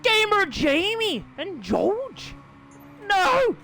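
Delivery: high, loud, drawn-out disbelief; high-pitched shouted disbelief; incredulous outcry. Real, not acted. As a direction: wail in protest at the result. Outcry at race result.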